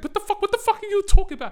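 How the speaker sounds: very high-pitched, indignant imitation; mock outraged denial. Accused boyfriend answering her in retelling, high mock outrage. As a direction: squawk it back as the accused boyfriend.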